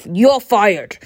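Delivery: a hint of a boss's loud firing bark; Alan Sugar. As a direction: snap it out like a TV boss. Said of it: "Full Alan Sugar".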